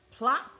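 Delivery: punchy splash sound; bright splash plop; loud plop. A narrator's reading. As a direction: pop it out loud.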